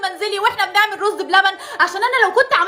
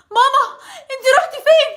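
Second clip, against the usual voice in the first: normal talking voice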